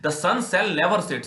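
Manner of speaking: reading the English passage slowly and deliberately; measured classroom read-aloud; slow text reading. Shown as a demonstration.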